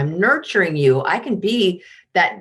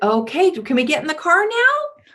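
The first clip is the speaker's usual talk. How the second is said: much higher, rising, pleading question; uncertain asking mom; 9 semitones higher than usual. Retold or imitated. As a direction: float it up like a hopeful plea. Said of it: "ending like".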